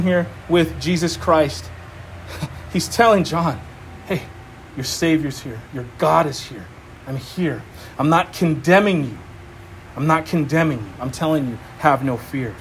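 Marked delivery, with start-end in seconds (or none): 4.1-12.5 tender reassurance. Retold or imitated. Jesus reassuring John.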